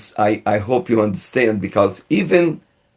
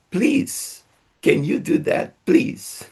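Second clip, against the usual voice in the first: sweet pleading please; 6 semitones higher, 4 dB quieter than usual. Shown as a demonstration. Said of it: "say the magic word at the end".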